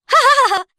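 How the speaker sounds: loud high evil cackle; witch cackle. Acted. Witch mocking Gretel, loud high cackle. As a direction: burst into a wicked cackle.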